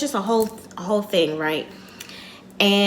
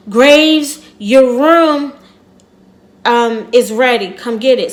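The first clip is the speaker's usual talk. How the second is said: loud front-desk call across a lobby; hotel clerk shouting for the next guest; calling out a name; 2 semitones higher, 8 dB louder than usual. Retold or imitated.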